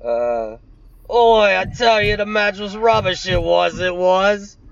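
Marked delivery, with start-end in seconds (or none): 1.1-4.6 louder, higher geezer voice; mock Cockney. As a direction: ham up the London moan. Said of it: "That's better".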